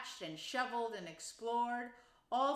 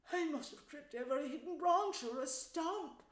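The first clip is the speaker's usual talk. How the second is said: miserably; 7 semitones higher than usual. A narrator's reading. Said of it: "said the mole miserably".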